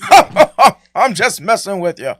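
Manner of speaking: laughing imitation. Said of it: "In the words of".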